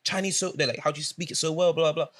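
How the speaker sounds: high, amazed shopkeeper; shocked surprise. Retold or imitated. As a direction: sound stunned they speak it. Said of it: "you're shocked, you're surprised".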